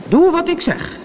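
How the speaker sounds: high-pitched, forceful order; commanding call. A narrator's reading. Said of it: "riep Peter Pan".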